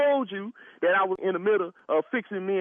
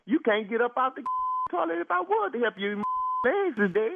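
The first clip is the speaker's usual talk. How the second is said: laughing; 7 semitones higher than usual. Real, not acted. Mocking the numb caller.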